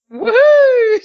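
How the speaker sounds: loud, high-pitched cheer; excited whoop. Real, not acted. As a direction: whoop it out in celebration. Cheering the silly final question.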